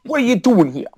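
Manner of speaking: high-pitched comic impression; Tracy Morgan. Trading celebrity impressions with co-host, high comic voice. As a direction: pitch it up into a rough comedian impersonation. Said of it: "pretty good Tracy Morgan".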